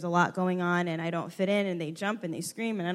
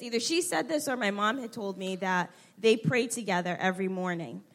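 ordinary talk

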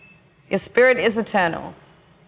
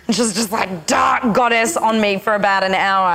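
normal talking voice

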